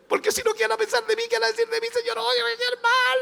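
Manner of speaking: high, squeaky comic fretting; hammed-up panicky believer; mock anxious worry. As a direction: fret to God in a tiny high voice. Same worried believer, comic voice.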